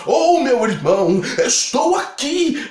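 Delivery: hammed-up pompous address, rising high on the end; mock solemn greeting. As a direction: greet a friend like a churchy prayer, overdone. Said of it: "se eu chegar pra você assim". (saudação teatral e exagerada, em tom de oração)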